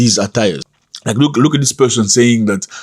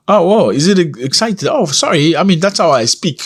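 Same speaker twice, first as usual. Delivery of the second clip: higher, playful mock apology; joking reply; 7 semitones higher than usual. Woman answering critics of her English, jokingly. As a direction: tease the critics with a light fake sorry. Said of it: "she jokingly made a comment about it".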